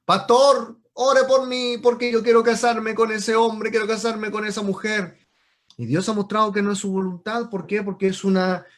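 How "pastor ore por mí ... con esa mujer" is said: loud, raised, insistent plea; hammed-up urgent request; pleading believer. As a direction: imitate a believer begging the pastor for prayer. Acts out believer begging pastor's prayer.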